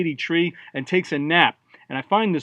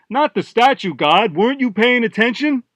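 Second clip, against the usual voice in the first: higher, exasperated correction; 7 semitones higher, 4 dB louder than usual. Acted. Captain to the bearded guy who wasn't listening, exasperated. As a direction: scold someone who missed the point. Captain scolds inattentive crewman.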